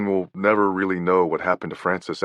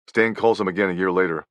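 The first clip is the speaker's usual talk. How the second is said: plain reading voice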